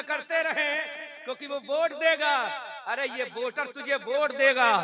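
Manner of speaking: from firm talk building to a loud shout; building sermon rebuke. Acted. Preacher rebuking vote-chasing Muslims, building from firm to shouting. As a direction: build to a roar.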